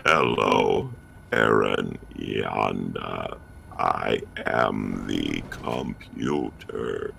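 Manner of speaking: very low, slow robotic drone; computer voice. Pretend. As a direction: speak each word like a machine. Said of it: "My favorite computer voice is the speaking in words voice".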